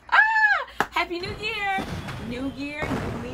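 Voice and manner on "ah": high, loud excited squeal; a burst of party joy. Real, not acted. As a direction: let out a sharp happy shriek. Squeals at the new year.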